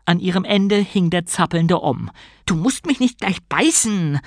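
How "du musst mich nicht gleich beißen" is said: Bruta voice. A narrator's reading. Bruta bitten, cries out.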